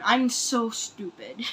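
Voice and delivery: normal talking voice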